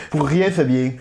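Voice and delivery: put-on playful south-western French rugby accent; Ovalie accent. Brushing off co-host's question, in a hammed-up Ovalie accent. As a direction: lean into the Ovalie twang on each ending. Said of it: "Pourquoi tu prends l'accent, euh, Ovalie".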